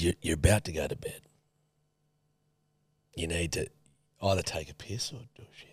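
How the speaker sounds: quietly retold; hushed, a bit fast; whispered quote. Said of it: "You don't need to whisper".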